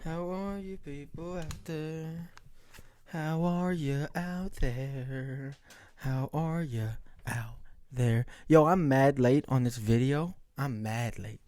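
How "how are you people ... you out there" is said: very slow, stretched-out words, softly; drawn-out greeting. Real, not acted. Vlogger opening the video to viewers, drawn-out greeting. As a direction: stretch each word of the greeting out.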